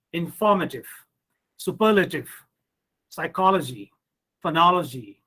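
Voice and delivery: inFORmative, suPERlative, psyCHOLogy, phoNOLogy; third-from-end stress. Shown as a demonstration. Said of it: "So that's why for is taking stress".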